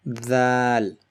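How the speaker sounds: Dal with tongue between the teeth; bitten Dal. Shown as a demonstration. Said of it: "Dal is pronounced when you basically bite".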